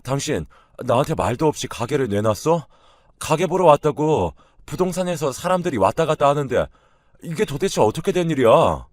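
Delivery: loud, high-pitched demanding husband; angry confrontation. A narrator's reading. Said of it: "제게 찾아와 따지기 시작했어요".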